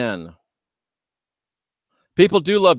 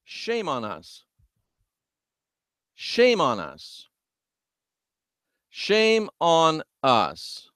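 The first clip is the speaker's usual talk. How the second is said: slow solemn reproach; 2 semitones higher, 5 dB quieter, much slower than usual. Real, not acted.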